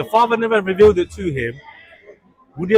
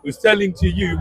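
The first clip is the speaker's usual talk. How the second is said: usual speaking voice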